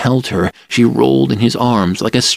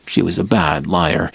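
usual speaking voice

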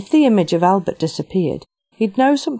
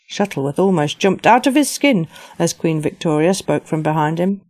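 ordinary delivery

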